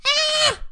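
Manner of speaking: very high startled yelp; falling cry. A narrator's reading. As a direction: cry out as you slip and drop. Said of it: "he cried and disappeared".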